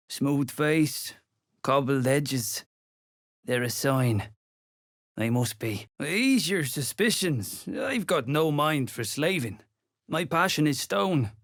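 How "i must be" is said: usual speaking voice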